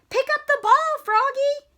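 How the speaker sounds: very high, loud urgent yell; coach's yell. Acted. Coach yelling at Froggy to grab the ball, loud. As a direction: shout the order hard. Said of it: "yelled the coach".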